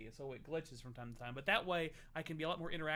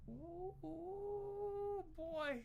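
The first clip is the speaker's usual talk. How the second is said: put-on squeaky high ooh boy; high-pitched ooh; 14 semitones higher, 4 dB quieter, much slower than usual. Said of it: "You sound like you're high as balls".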